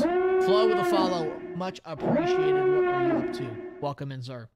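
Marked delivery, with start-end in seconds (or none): 0.0-1.4 high, loud drawn-out hype call; hyped shout-out. Pretend. Hyped shout-out to new follower. 1.6-2.5 very high, loud mock-hype thanks; hyped shout-out. Hyped thanks, then repeated plainly. 2.5-3.5 hyped shout-out. Pretend. Hyped greeting to new follower.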